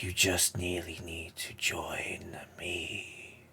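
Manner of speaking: very slow, whispery villain; Palpatine. Acted. Villain invites player to join him, slow whisper. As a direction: coax them in a soft, drawn-out hush. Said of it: "that Palpatine voice".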